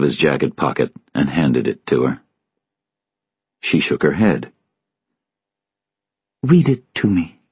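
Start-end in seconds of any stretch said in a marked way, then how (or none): 6.4-7.4 high, hushed request; high-voiced grieving woman; Sylvia's voice. A narrator's reading. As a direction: ask gently in a much higher voice. Narrator voices Sylvia asking to hear note.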